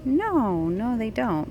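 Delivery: ordinary delivery, nothing marked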